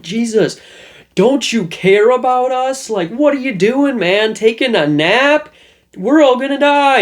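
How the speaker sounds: high, loud panicked cry; freaking out. Retold or imitated. Disciples in the storm wake Jesus, panicking in a high, loud voice. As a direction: shriek it like men sure they'll drown. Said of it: "they're all freaking out".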